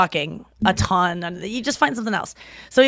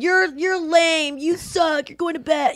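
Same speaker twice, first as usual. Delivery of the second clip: higher, slow scornful taunt; drunk taunting; 7 semitones higher, slower than usual. Retold or imitated. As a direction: sneer at the quitters. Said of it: "I would tell everyone".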